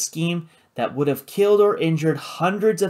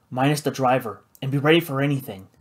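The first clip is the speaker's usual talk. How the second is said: usual speaking voice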